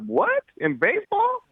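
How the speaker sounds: high-pitched incredulous question; shocked disbelief. Retold or imitated. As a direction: act out being stunned, voice leaping way up. Reacts to draft rumour, retold.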